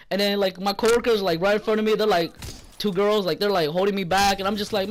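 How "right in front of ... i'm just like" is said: normal talking voice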